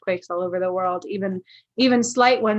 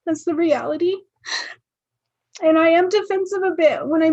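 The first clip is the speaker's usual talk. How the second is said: real tearful voice, pitched high; emotional; 7 semitones higher than usual. Real, not acted. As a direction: choke back tears while confessing hurt. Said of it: "I'm getting a little emotional".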